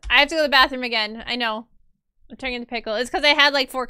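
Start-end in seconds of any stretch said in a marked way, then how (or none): 0.1-1.2 fast and louder, punching have; louder, quick. Real, not acted. Apologising to the stream viewers, quick and louder. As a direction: blurt it out quickly. Repeats bathroom news aloud.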